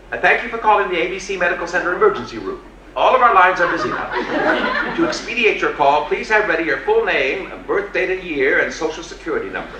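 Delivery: brisk automated emergency line; recorded message voice. Retold or imitated.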